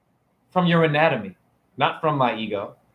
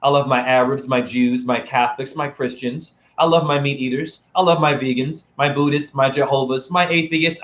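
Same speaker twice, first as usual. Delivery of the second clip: usual speaking voice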